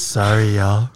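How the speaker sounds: loud mock sexy voice; hammed-up seductive apology. Shown as a demonstration. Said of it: "like, my sexy voice?".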